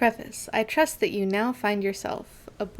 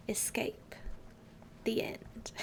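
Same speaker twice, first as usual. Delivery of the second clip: breathy hushed whisper of a spooky title; mock spooky whisper; 13 dB quieter, slower than usual. Streamer reading creepy chapter titles to chat, mock spooky whisper. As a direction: whisper it like a ghost story.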